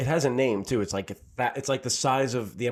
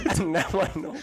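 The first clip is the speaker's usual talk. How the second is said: laughing; 6 semitones higher than usual. Real, not acted. Laughing at own dating failure.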